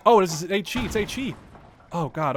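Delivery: high, loud burst of alarm; startled scare. Real, not acted. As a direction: blurt it out in sudden fright. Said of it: "I was so scared there".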